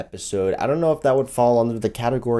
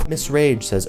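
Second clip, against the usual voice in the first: usual speaking voice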